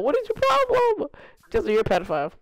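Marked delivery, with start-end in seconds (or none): 0.0-1.1 very high indignant question; mock disgust; squeaky outrage. Real, not acted. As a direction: shriek it in mock disbelief.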